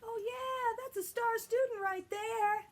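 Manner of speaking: excited praise. Pretend. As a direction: cheer a star student on. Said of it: "I'm gonna give you shout outs like".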